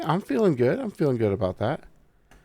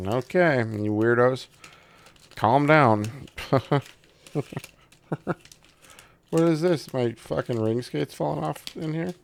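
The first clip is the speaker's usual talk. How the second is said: ordinary talk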